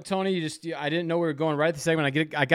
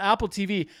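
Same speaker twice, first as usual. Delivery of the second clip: ordinary talk, nothing marked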